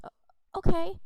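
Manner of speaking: squeaky high startled okay. Acted. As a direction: jump out a surprised, loud okay. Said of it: "she goes".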